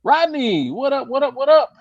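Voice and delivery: high, loud what up; excited greeting. Real, not acted. Host hyping up a friend who joined, high and excited. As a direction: hype up a friend joining.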